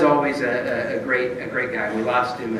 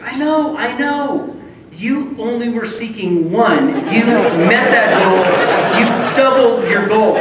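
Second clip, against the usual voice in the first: high, louder, slow cheerleading; upbeat boss; 9 semitones higher, 8 dB louder, slower than usual. Retold or imitated. Ron cheering up the losing lawyer, upbeat. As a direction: sell the silver lining.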